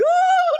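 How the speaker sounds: very high, loud imitated bawling. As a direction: burst into exaggerated harder crying. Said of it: "lebih keras lagi". (tiruan tangisan makin keras dan melengking)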